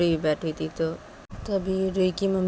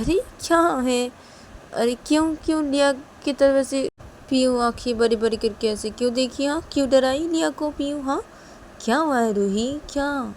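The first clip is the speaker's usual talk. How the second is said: father's insistent, higher voice; indignant questioning; 7 semitones higher than usual. Acted. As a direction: demand an explanation. Father indignant child was scared.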